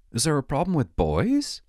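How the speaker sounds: normal talking voice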